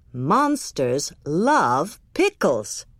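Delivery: low, slow knowing mother; mom voice. A narrator's reading. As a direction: tell it like a known fact. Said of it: "said Mom".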